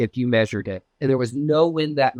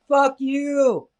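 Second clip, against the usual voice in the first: retold scream; 9 semitones higher than usual. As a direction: yell it up at the owl in the tree. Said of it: "I freaking screamed out".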